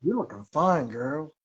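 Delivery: quick, barely seductive take; half-hearted sultry voice. Pretend. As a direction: say it almost plain, a touch flirty. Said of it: "in the most sultry voice you can".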